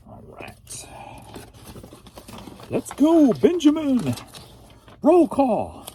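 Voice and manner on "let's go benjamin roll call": high, loud calling-out voice; high-pitched roll-caller; roll-call shout. Pretend.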